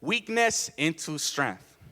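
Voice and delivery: "weakness into strength" very slow, each word weighted; slow emphatic statement. Real, not acted. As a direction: lay down "weakness into strength" heavily and slowly. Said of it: "Second W".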